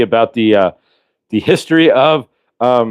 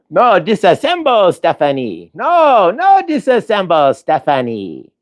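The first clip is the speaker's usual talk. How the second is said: high-pitched robot pleading, hammed-up; Johnny Five; 9 semitones higher, slower than usual. Robot begging Stephanie, high-pitched mock plea. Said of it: "way too much Johnny Five".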